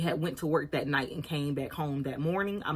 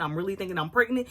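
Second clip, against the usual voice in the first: ordinary delivery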